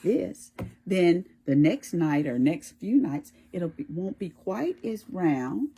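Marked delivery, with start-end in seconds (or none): none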